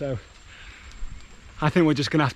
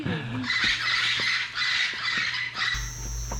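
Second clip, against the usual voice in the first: breathy laugh. Real, not acted. Laughing after sloth voice.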